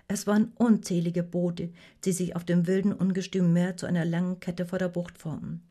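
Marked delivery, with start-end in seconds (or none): none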